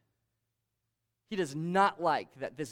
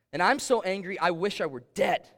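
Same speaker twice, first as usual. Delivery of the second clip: angry Jonah; 5 semitones higher, 8 dB louder than usual. Acted. Jonah's angry reply in scripture.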